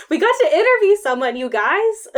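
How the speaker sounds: high, loud, fast gush; excited. Real, not acted. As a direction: blurt it out thrilled. Bursting with excitement.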